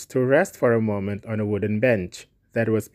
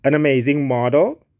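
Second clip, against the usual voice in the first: louder, incredulous echo with rising end; mild narrated shout; 2 semitones higher, 5 dB louder than usual. A narrator's reading. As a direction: throw it back as an understated shouted question. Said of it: "shouted Trevor at the top of his voice".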